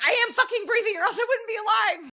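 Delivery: high, loud frustrated outburst; exasperated retort. Retold or imitated. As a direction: snap back at just breathe. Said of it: "And I'm like".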